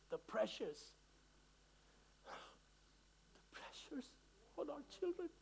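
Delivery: barely getting the words out, overcome; real emotion, voice nearly gone; choked up. Real, not acted. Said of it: "I'm very emotional about this".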